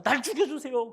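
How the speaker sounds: loud, high, angry plea to die; angry cry. Retold or imitated. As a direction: shout it like a sulking prophet in a rage. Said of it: "화가 나서". (화난 외침)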